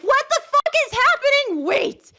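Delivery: high, loud panicked shout; retold scream. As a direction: scream it as if the pain won't stop. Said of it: "as I'm screaming".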